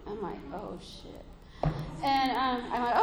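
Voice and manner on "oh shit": whispered, hushed curse; whispered dread. Retold or imitated. Mother bracing for her daughter's personal news, whispering. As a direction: breathe it out like dread.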